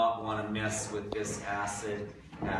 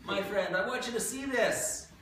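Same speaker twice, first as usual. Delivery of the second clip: coaxing call; 11 semitones higher, slower than usual. Real, not acted. Calling a student to look.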